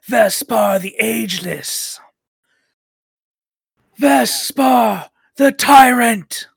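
booming villain proclamation; loud, grand tyrant declaring himself; echoing voice inside helmet. Acted. GM voicing villain Vespar's speech.

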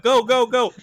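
loud, high urging yell; urgent shout. Retold or imitated. Speaker to Molly in the parking lot, urgent shout. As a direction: yell it to get the driver moving. Retelling urging the getaway driver.

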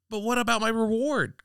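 high, anxious cry of the crane; anxious call. A narrator's reading. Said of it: "called the crane anxiously".

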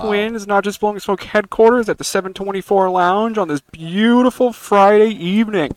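booming arena announcer voice; big boy announcer, loud and stretched; fighting game announcer. Acted. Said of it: "your big boy announcer voice".

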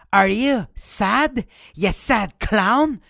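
slow, drawn-out mocking taunt. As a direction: sneer it slowly like a schoolyard bully. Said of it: "On dirait que tes vêtements". (moquerie lente et traînante)

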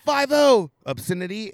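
light mock police chirp; cop chirp imitation. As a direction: toss it off like a cop over a loudspeaker. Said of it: "they said".

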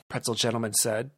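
ordinary delivery

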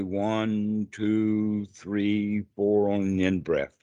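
slow, even count with drawn-out numbers. Shown as a demonstration. Teacher timing a student's in-breath, counting slowly. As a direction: count steadily to pace a long breath. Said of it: "Counting slowly".

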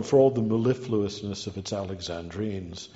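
normal talking voice

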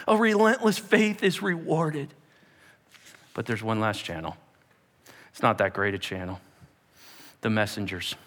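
normal talking voice

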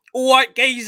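loud, high caricature of an Essex lad; broad Essex accent, hammed-up and yelled; mock Essex geezer. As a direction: shout it like a cartoon Essex geezer. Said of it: "whereas Paul's like".

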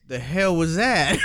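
startled, slow gruff realtor voice; baffled realtor. Retold or imitated. Retelling the realtor's reaction, mock baffled slow. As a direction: play the confused realtor, drawn out. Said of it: "the fucking realtor literally went".

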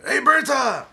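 high, loud holler imitating a kid; imitated yell. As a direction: yell out like a boy on a loudspeaker. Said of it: "And he yells out".

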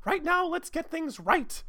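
put-on high girlish voice; Amy. Amy answering Sonic in a high girl voice. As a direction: squeak it like a flustered girl.

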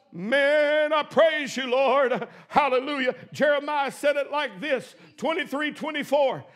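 ordinary talk

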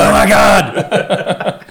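loud, slow laughing exclamation. Real, not acted. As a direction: laugh through the words. Cracking up at own joke.

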